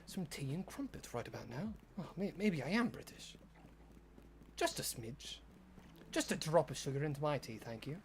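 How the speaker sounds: soft, lightly posh English voice; a gentle put-on British lilt, quiet; posh British accent. To chat, musing he might be British in soft posh English. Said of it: "doing voices is fun".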